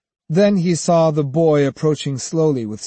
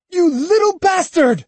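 high, loud furious yell; angry shout; 5 dB louder than usual. A narrator's reading. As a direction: scream the insult. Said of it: "shouted Kojiro".

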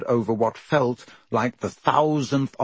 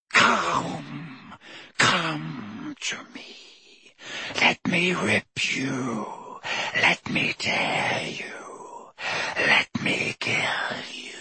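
slow, hushed, otherworldly hiss fading to a whisper; a hidden monster calling its prey through the walls; ice-cold venomous voice; 4 semitones higher, 4 dB quieter, much slower than usual. Acted. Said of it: "a voice of breathtaking, ice-cold venom".

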